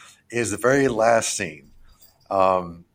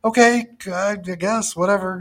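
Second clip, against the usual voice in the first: mock resignation; 11 semitones higher, 3 dB louder than usual. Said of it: "I'm like".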